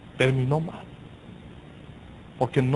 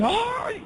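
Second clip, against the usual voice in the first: pained ay. Retold or imitated. Preacher quoting his own pain, high thin ay. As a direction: let out a thin high ouch. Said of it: "Y dice".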